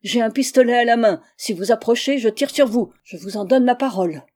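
loud warning from a frightened man; trembling cry. A narrator's reading. Frightened man warns visitors in the dark, crying out. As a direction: call out a nervous threat, loudly. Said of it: "une voix tremblante qui nous cria".

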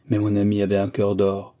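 ordinary delivery, nothing marked

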